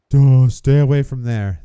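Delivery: loud exasperated call; loud warning. Real, not acted. Gamer yelling at his character to stay away. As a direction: shout the warning.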